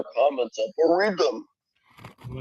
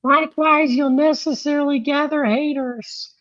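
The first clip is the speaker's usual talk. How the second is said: much higher-pitched reading voice; put-on high voice; 10 semitones higher than usual. Host reading out advice on haters, put-on high voice. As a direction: read it out in a silly high voice.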